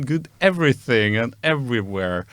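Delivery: ordinary talk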